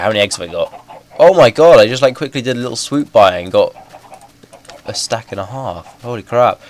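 ordinary talk, nothing marked

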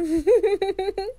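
high giggle, coy; a coy high laugh; high flirty giggle. Acted. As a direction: giggle like you're flattered. Flirty giggle after compliment.

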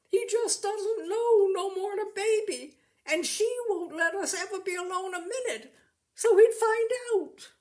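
put-on high, loud wailing sob of a girl; sobbing. Rosie sobbing to the farmer about her husband. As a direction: sob it out, pitch climbing to a wail.

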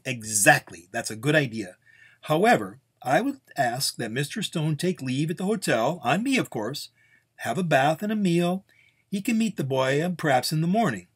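smooth things over with an offer; accommodating host. Acted. Carlsbad proposes hotel and morning meeting.

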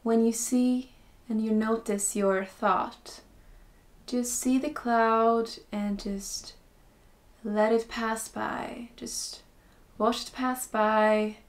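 slow, calm meditation voice with long pauses; guided meditation. Acted. Teacher guiding beginners into breathing and stillness, slow guided meditation. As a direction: ease them in slowly, softening as phrases end.

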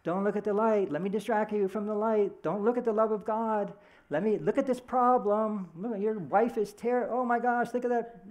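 high, insistent tempter voice; the ego tempting. Pretend. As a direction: coax and distract like a pushy little voice. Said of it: "Personalities are like".

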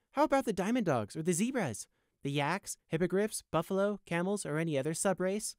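cheeky, insistent debater; argumentative captive. A narrator's reading. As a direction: reel off the questions to prove a point.

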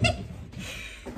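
high, wheezy unvoiced laugh; real laugh. Real, not acted. Storyteller amused by the wolf mix-up, laughing. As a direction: laugh helplessly at your own story.

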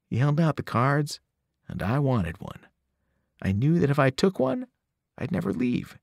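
plain reading voice

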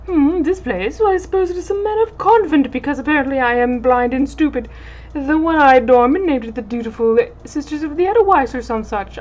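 mock his own stupidity; sarcastic dandy captain.

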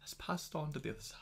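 hushed whisper; a hammed-up solemn whisper; mock whisper. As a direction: breathe it out reverently.

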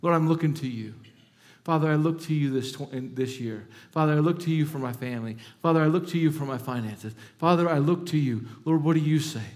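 hushed, earnest prayer to the Father; a soft, intimate prayer voice; quiet prayer. Acted. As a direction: pray it softly and sincerely. Models a personal prayer.

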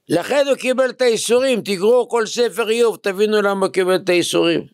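high, insistent preacher's delivery; emphatic preaching. Real, not acted. Rabbi explaining Job's suffering to students, emphatic preaching. Preacher drives home his point.

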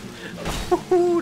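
loud high relieved laughter. Real, not acted. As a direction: laugh out the shock. Relief after near fall. (erleichtertes lautes Lachen)

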